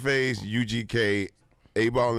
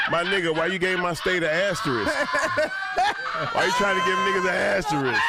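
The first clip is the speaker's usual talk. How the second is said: mock indignant friend, raised pitch and laughing; a touch of an offended Push; imitating Push; 8 semitones higher than usual. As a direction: play Push complaining with a laugh. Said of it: "I don't want Push, Push to be like".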